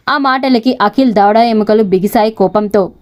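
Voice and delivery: plain reading voice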